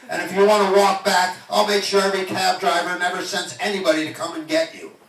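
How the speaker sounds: loud, high, furious tirade with laughs; angry rant. Retold or imitated. Cab driver shouting at the rich passenger, loud angry rant. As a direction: tell him off at full volume. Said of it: "that really ticked me off".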